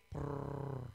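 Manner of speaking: a low rolled cat purr, lips trilling. Pretend. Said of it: "di ka sasagot ng".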